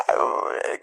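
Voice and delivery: a breathy choked sob; a sob escaping mid-sentence. Real, not acted. Describing seeing her mother again, a choked sob. Reunion with mother, emotion overflows.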